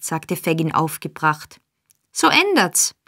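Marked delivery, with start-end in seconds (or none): 2.1-2.9 much higher, a laughing jab; with a short laugh. Acted. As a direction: toss it off with a scornful laugh. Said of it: "mit einem Auflachen".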